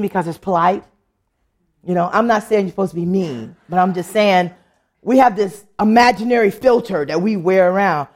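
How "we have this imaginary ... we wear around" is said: normal talking voice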